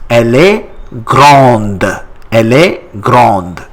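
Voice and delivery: grande with the final D clearly sounded. Shown as a demonstration. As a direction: model "grande" in French, releasing the D. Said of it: "we pronounce as grande".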